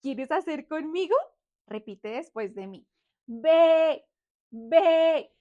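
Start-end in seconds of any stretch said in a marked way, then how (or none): 3.3-5.3 loud, drawn-out sheep baa; imitación de oveja. Shown as a demonstration. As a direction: bleat loudly for kids to copy. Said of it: "La oveja hace ve". (balido fuerte de oveja)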